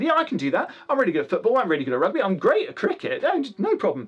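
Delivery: high, swooping mock enthusiasm; hammed-up eager boasting, pitch leaping up; over-eager schoolmate. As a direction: brag like a keen kid at school. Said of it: "was very enthusiastic".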